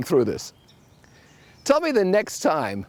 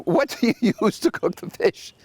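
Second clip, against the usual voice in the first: exasperated laugh; 7 semitones higher than usual. Retold or imitated. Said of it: "I said".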